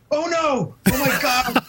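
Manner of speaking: high-pitched imitation of horrified cameramen; mock dismay. Film crew realizing they missed the shot, mock high-pitched dismay.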